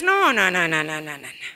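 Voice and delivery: low, dropping string of no's, dismissive; a mother waving off ballet; mock flat refusal. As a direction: shut the idea down hard.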